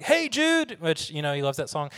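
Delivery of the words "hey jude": put-on kids calling out. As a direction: sing it out high like a preschool kid. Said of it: "all these kids are like".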